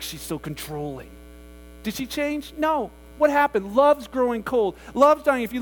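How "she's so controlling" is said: mock disgust. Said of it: "it's like".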